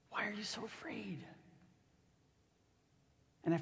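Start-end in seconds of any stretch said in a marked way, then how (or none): 0.1-1.2 soft, near-whispered reproach; hushed, breath-only questioning. Acted. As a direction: drop to a whisper and ask. Jesus gently questions frightened disciples.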